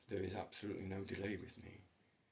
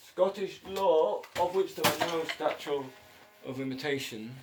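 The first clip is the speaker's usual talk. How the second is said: booming mock proclamation; 6 semitones higher, 10 dB louder than usual. Mock-pompous announcement of Scottish law to listeners, high and loud. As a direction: declare it to the whole court.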